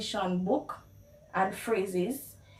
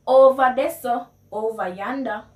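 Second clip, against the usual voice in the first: loud Patois pointing call; Jamaican Patois; 2 semitones higher, 8 dB louder than usual. Shown as a demonstration. Pointing a friend somewhere, in loud Jamaican Patois. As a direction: call out Over there in Patois. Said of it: "how you would translate".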